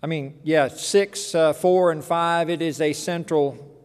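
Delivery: normal talking voice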